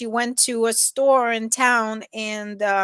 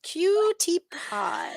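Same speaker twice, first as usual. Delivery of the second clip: exaggerated pet cooing; 8 semitones higher, 4 dB quieter, much slower than usual. Real, not acted. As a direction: stretch each syllable to dote on the dog. Repeats the coo, more drawn out.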